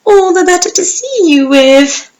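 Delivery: the wolf coaxing her closer; wolf purring sweetly as granny. Acted. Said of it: "So the wolf said sweetly".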